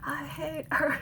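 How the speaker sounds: a hint of a child's whine; imitating child self. Retelling her child self's complaint, mild child whine. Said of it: "I'm just like".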